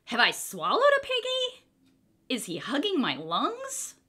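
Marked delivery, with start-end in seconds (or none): none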